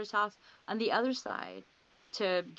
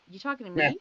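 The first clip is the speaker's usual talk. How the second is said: mock tough-guy "you talking to me", louder; incredulous comeback; 5 dB louder, faster than usual. Baffled at the psychic who knows her Sasquatch, mock tough retort. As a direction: act baffled, throw the question back at her.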